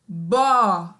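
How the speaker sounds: "bó." said loudly with an open o; a teacher calling out "bó." loud and clear; syllable sounded out. Shown as a demonstration. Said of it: "que vai ficar". (sílaba "bó." dita alto com o aberto)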